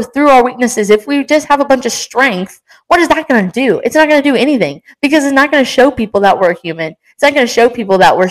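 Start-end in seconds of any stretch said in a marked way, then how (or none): none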